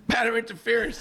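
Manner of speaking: "Batter interference" pitched high, rising in disbelief; incredulous repeat. Real, not acted. Mocking the umpires' call to cohost, incredulous. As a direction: echo the call like it's absurd.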